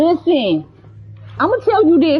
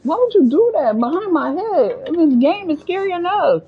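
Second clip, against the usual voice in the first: high, swooping, exasperated complaint; startled scolding; 8 semitones higher than usual. Real, not acted. Player scolding a game character for a scare, startled and exasperated. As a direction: scold it in a startled sing-song.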